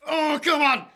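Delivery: loud encouraging yell; cheering shout. Real, not acted. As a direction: shout him on, high and loud. Cheering him on to rip.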